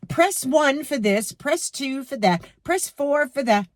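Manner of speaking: automated phone menu. Retold or imitated. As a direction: lightly mimic a recorded hotline.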